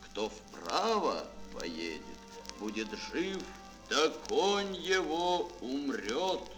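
ordinary talk, nothing marked

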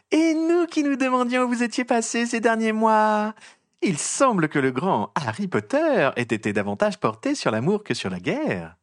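high, slow, sugary mocking drawl; a syrupy nosy reporter savouring every word; trumpeting gossip journalist. A narrator's reading. Rita Skeeter taunts Harry in front of the mourners, high and mocking. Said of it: "il entendit claironner une voix qu'il avait appris à détester".

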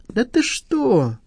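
high, stretched-out protest; indignant. A narrator's reading. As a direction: draw it out high in affronted disbelief. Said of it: "возмутился байкер".

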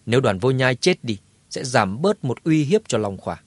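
plain reading voice

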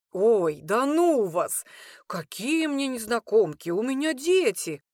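a hint of an embarrassed father fending off teasing; brushing it off. A narrator's reading. Cook brushes off the girls' teasing, flustered. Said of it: "отмахнулся он".

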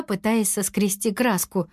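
usual speaking voice

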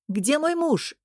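mild, high-pitched call. A narrator's reading. Gota calls through the window for her husband, mild cry. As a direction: call out the question without full volume. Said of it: "крикнула она в окно тем".

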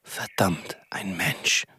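unvoiced whisper of annoyance; whispered curse at being caught; muttered curse. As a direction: hiss it aside so nobody hears. Villain curses under breath.

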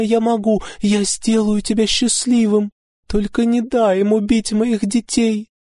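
very high tearful begging; desperate mother; frantic pleading. A narrator's reading. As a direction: grasp at the last hope, sobbing. She clutches at last hope.

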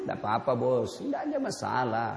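low, gentle, unhurried voice of the Prophet; calm Prophet. Acted. The Prophet gently answers the man who spat, low and calm. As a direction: smile through the words, easy and forgiving. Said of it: "Nabi tetap senyum".

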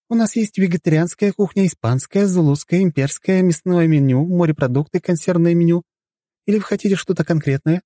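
very high-pitched maid reciting a menu; efficient servant girl. Acted. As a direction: list the cuisines as a dutiful waitress. Voices the servant listing menus. (услужливая девушка, высоким голосом)

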